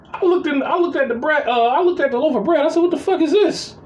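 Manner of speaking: a high, stunned what the fuck; shocked outrage. Retold or imitated. Older brother to lazy sibling, high-pitched outraged disbelief. Finds the wrong bread.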